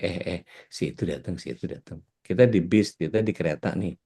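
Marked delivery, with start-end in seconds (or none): 0.0-2.0 low, hushed heads-up to a friend; hushed warning. Acted. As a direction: drop almost to a whisper, as if tipping someone off. Diam-diam memberi tahu teman. (peringatan lirih setengah berbisik)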